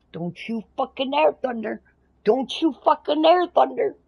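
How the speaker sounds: rising high and loud playful warning; hammed-up threat, building to a shout; mock threat. Mock-threatening friend who muted her.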